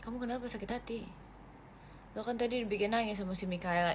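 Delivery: normal talking voice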